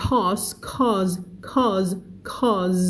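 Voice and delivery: cause drilled slowly with a buzzing Z ending; teacher drilling cause; model pronunciation. Shown as a demonstration. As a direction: repeat cause for the students. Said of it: "Cause นะคะ".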